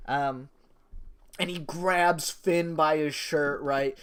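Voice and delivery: ordinary talk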